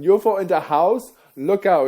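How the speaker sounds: a hint of a German accent, high and loud; mock German accent, hammed-up. Joking with the crowd in a mock German accent. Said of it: "It gets with the German accent".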